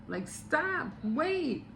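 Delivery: high, softened calling out; high-pitched, held-back mock yell; mock scream. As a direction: imitate screaming after someone without the volume. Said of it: "screamed out her name".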